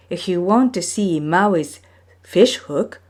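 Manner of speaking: plain reading voice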